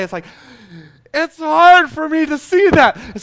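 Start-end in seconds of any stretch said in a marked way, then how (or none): 1.1-3.2 loud, high, drawn-out complaint; mock anguish. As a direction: play up a dad's exaggerated pain. Retold dad's pained reply to kid.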